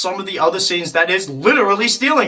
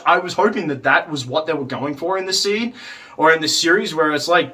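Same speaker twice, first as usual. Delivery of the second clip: normal talking voice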